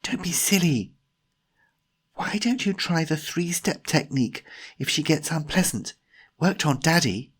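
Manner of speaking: put-on high young woman character; Jemima's brisk scolding. Jemima suggests the technique to Timothy, high scolding voice.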